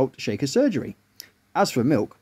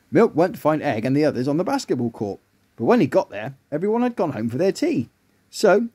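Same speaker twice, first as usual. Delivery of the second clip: ordinary delivery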